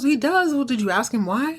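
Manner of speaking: mildly startled, quick questions; surprised mom. Retold or imitated. Karen surprised by her son's answer.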